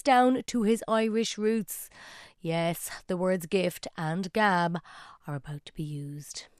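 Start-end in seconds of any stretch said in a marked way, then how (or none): 5.2-6.5 softer conspiratorial whisper, letting the listener in on it; playful whisper. Real, not acted. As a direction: whisper it like a shared secret. Presenter teasing the coming clip.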